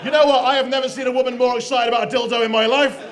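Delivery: loud, punchy crowd roast; loud comic outburst. Real, not acted. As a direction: belt it out to the room. MC roasting excited audience member.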